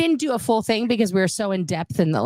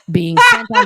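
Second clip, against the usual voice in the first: loud burst; 9 dB louder than usual. Real, not acted. Gross joke punchline.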